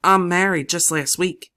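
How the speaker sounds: Danny excitedly telling Lily his news. A narrator's reading. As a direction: blurt it out happily. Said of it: "he says excitedly".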